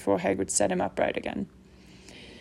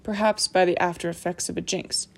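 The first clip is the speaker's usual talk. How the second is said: ordinary delivery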